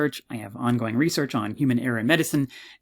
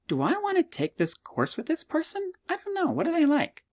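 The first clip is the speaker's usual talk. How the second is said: very high, squeaky student voice; wondering student. Acted. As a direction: put on a high-pitched, doubtful student.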